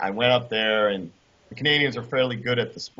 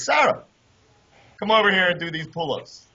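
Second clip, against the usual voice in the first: high, loud call across the room; 6 semitones higher, 4 dB louder than usual. Retold or imitated. Coach calls Sarah over to do pull-ups, loud high call. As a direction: shout her over to show him up.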